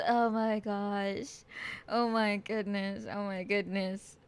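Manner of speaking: low, soft, slow breathy awe; overwhelmed hush. Real, not acted. Streamer catching her breath to chat, hushed. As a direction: let it sink in, almost speechless. Overwhelmed after excited outburst.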